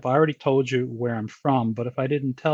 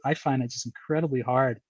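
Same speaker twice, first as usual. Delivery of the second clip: ordinary talk, nothing marked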